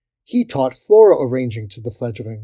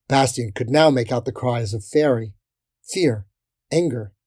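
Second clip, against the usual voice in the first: plain reading voice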